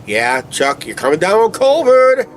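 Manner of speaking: high, sing-song mock of a know-it-all commenter. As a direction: tease like a snarky viewer. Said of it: "I can see it in the comment section".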